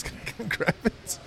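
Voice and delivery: laughing quote. Retold or imitated. Salesman asks for jar back.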